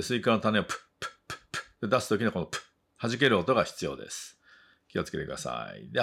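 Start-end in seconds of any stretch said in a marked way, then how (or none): 0.6-1.6 "プッ" as airy pops, like spitting melon seeds; popping p sound. Shown as a demonstration. Teacher showing English p, seed-spitting "プッ". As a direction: spit out each "プッ" with no vowel.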